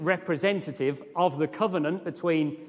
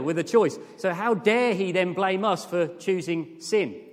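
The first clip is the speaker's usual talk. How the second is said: usual speaking voice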